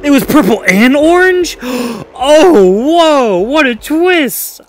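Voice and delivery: high, loud gasping disbelief; real shock, gasping between shrieked phrases; shocked outburst. Real, not acted. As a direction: cry out at a stunning betrayal. Shocked by the game's reveal.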